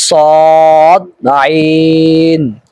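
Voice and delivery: সদ and আইন said thick and full, drawn out; heavy letters. Shown as a demonstration. Teacher showing heavy Arabic letters. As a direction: give সদ a heavy, full sound. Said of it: "দুইটাই একটু মোটা করে হবে".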